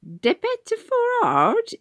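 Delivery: "De Bedford Forrard" mangled in a very high, slow, mocking voice; mock country pronunciation. Ticket man mimicking Tom's accent, mocking. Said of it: "imitated the man".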